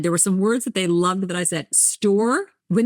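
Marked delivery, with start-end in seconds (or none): 1.7-2.5 Jersey "Store" stretched toward two syllables; New Jersey two-syllable "Store"; New Jersey accent. Shown as a demonstration. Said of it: "from New Jersey, you say".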